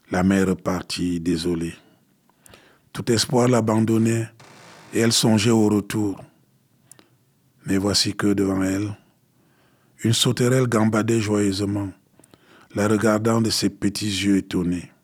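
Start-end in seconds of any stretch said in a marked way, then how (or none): none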